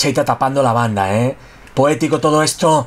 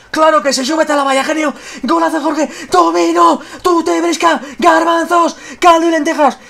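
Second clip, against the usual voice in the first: breakneck goal commentary; 12 semitones higher, 4 dB louder than usual. Real, not acted. Goal by Jorge Molina, screamed.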